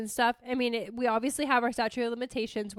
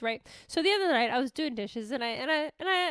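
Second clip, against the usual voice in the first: usual speaking voice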